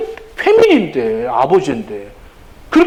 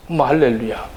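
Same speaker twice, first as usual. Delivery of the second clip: normal talking voice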